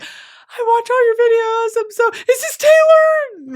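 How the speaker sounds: put-on high, bubbly young fan voice; gushing fan. Excited fan gushing at the comedian, high-pitched. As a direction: gush at your idol. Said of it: "She was like".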